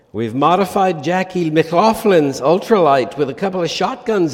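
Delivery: hammed-up Irish pub caller declaring war; attempted Irish accent. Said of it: "needs an Irish accent".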